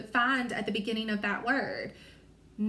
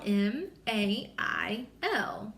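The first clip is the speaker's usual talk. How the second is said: letters spaced out slowly; slow spelling; much slower than usual. Shown as a demonstration. Teacher to class, spelling man letter by letter. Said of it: "We spell it mail".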